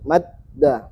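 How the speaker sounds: slow "madda" with a doubled d; "madda" split as mad, pause, da; pressed d. Shown as a demonstration. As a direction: hold the closed d in "madda", then release. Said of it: "Jadi ditekan".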